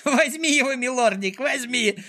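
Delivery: very high, joyful squealing villain; high-pitched gleeful urging. A narrator's reading. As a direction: egg the dog on in a delighted squeal. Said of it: "радостно завизжал Клопс".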